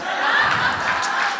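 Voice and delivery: high unvoiced laugh; breathy laugh. Real, not acted. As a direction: laugh at your own punchline. Laughing at own punchline.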